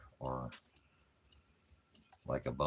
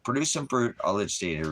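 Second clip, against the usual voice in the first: loud command; 6 semitones higher, 6 dB louder than usual. Real, not acted. Commanding the chatter.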